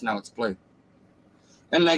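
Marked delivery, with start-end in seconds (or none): 0.0-0.6 "No, it's blue." lower and softer, flat-out stubborn; deadpan stubborn. Acted. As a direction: stare him down and say it low. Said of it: "just looks him in the face and says".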